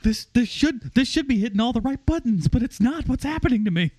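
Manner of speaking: high, bewildered mock panic; mock distressed viewer. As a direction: act out a man baffled by himself. Voicing Bruce baffled at not laughing.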